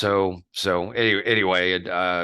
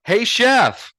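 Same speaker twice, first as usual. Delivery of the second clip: calling the chef; 13 semitones higher, 6 dB louder than usual. Retold or imitated. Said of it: "and he says".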